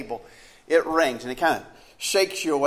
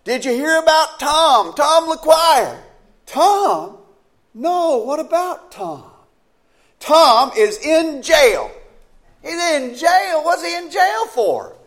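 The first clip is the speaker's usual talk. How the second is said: much higher and louder, slow and urgent; alarmed exchange; 12 semitones higher, 8 dB louder, slower than usual. Acted. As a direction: trade the bad news in rising alarm. Alarmed phone exchange acted.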